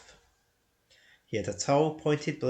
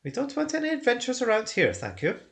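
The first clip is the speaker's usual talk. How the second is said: higher, louder hobbit shooing him off; fussy hobbit dismissal; 9 semitones higher, 4 dB louder than usual. Acted. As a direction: send him away politely but firmly. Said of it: "he said at last".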